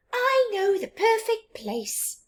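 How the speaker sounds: high squeaky mouse voice. Acted. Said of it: "squeaked the mouse".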